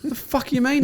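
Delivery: high-pitched retold disbelief; acted indignant disbelief; incredulous. Acted disbelief at bad news.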